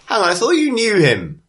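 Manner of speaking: high-pitched, incredulous townswoman; challenging bartender. Acted. Bartender interrupting the leader's speech, high and incredulous. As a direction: pipe up high with a puzzled objection.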